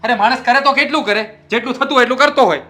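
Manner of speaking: loud, high yelling, rushing at the end; angry shout. Acted. Husband yelling he does what he can, angry shout. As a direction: vent your frustration loudly.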